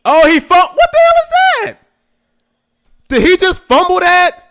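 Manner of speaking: high, loud disbelieving cry; shocked outcry. Real, not acted. Fan watching his team fumble, shocked high outcry. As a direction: yell in sudden disbelief.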